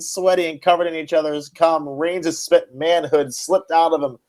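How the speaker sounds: barely holding it together while reading the raunchy lines; reading through laughter. Acted. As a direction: pitch up, fighting back giggles through the steamy finale. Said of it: "the final paragraph".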